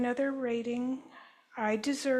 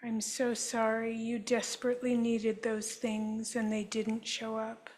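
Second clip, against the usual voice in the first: guided healing affirmation. Acted. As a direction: offer each apology gently, like a therapist. Leads group in healing statements.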